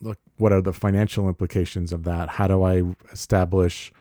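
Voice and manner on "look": ordinary talk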